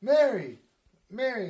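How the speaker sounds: high, loud call to wake a wife; acted holler. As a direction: call the name insistently. Joseph calling to wake Mary.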